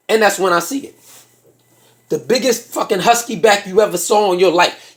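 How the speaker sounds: ordinary talk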